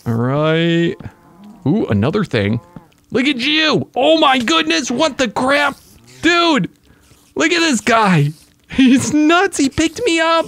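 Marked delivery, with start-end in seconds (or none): 3.1-10.5 delighted squeal. Real, not acted. Marvelling at a strange mob.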